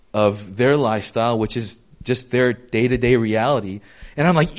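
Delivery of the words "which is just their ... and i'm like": usual speaking voice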